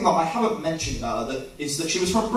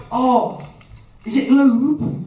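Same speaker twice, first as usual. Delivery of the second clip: hopeful eager ask; 4 semitones higher, 4 dB louder, slower than usual. Retold or imitated. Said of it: "And I was like".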